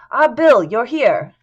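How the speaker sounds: loud, high-pitched hearty greeting; cheerful, almost ebullient. A narrator's reading. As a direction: hail an employee brightly from the doorway. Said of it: "He seemed cheerful".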